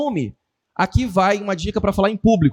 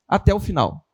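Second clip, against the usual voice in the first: ordinary talk, nothing marked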